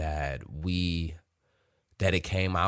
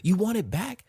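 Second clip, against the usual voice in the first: taunting mockery; 14 semitones higher than usual. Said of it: "And I was like".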